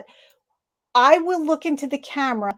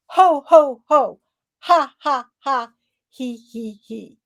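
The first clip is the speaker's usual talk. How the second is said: ho ho ho, ha ha ha, he he he, fading; laughter sounds in order; 4 dB louder, slower than usual. Shown as a demonstration. Coach correcting the order of laughter sounds. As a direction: run the three laughs in a row. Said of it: "if I didn't say them in the right order".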